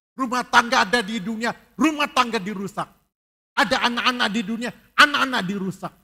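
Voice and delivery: loud, high fiery preaching; preaching at full force. Acted. Pastor warning the congregation, fiery loud preaching. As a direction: thunder it from the pulpit. Preacher warns of devil's destruction.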